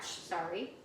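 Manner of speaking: ordinary delivery, nothing marked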